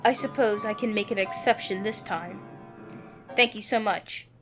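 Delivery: ordinary talk, nothing marked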